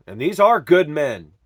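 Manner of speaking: usual speaking voice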